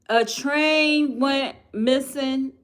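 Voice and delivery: spelling out the unbelievable news; incredulous. Real, not acted. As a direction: drag it out, stunned. Stunned announcing missing train.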